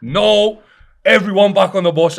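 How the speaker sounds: loud high shout of a furious manager. Retold or imitated. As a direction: shout the team back onto the bus. Manager yelling at players.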